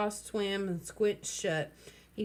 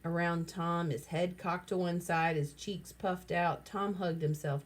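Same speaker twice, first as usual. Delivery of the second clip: plain reading voice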